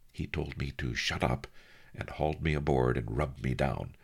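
usual speaking voice

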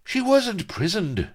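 high, loud, irritable correction; bad-tempered retort. A narrator's reading. Blood correcting Avery, bad-tempered and loud. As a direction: contradict him crossly, drawing it out. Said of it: "seemed to be in a bad temper".

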